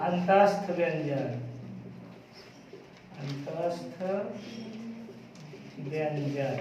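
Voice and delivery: low, soft word-by-word dictation; slow dictation. Real, not acted. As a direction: murmur it slowly for pupils writing it down. Teacher dictates term to write. (धीमे, रुक-रुक कर इमला)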